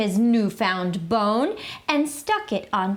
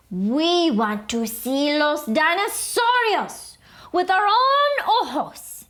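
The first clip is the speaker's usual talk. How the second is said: whispered start, then high excited; tiny dog begging; small pup; 7 semitones higher than usual. A narrator's reading. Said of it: "he said".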